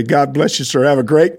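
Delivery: usual speaking voice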